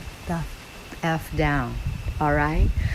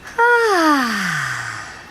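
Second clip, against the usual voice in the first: long "ah" sigh; one more long ah on the exhale. Shown as a demonstration. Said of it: "One more".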